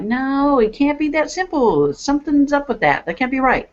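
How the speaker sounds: high-pitched doubting voice; disbelief. Retold or imitated. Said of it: "I'm like".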